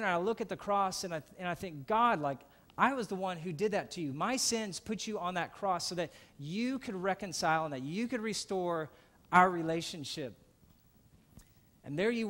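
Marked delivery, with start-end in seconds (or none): 1.9-10.4 loud, pleading cry to God; raw confession. Acted. Preacher cries out his guilt to God, loud and pleading. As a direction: confess it out loud, as if at the foot of the cross.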